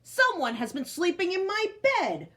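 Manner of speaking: Papa Bear finds his bed slept in and bellows; bellowed. A narrator's reading. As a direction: bellow it out, outraged. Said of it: "Papa bellowed".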